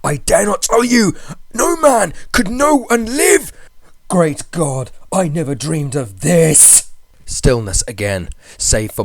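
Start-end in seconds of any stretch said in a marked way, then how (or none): 0.0-3.5 high, loud, desperate cry; fear and despair. A narrator's reading. Friend warning from below in fear and despair. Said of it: "hoarse with fear and now apparently tinged with despair". 4.1-6.9 horrified. Acted. Friend horrified below.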